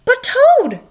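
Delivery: high and loud, pleading; high pleading Frog. A narrator's reading. Frog begging Toad not to sleep, high and loud. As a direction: Frog calls out to his friend. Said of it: "said Frog".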